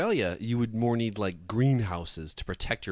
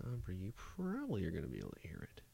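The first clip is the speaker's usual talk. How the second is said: whisper; 3 semitones lower, 13 dB quieter, faster than usual. Real, not acted. Aside while setting up screen.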